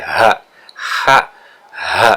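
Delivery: "ha" with a low bass in the throat; deep throat ha. Shown as a demonstration. Teacher shows students the bass in "ha". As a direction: let "ha" rumble low from the throat. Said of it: "Rasa kayak ada bass ya".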